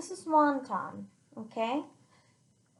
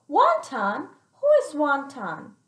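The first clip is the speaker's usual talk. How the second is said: loud, puzzled grandma asking; a surprised old lady, louder; 3 semitones higher, 8 dB louder than usual. Acted.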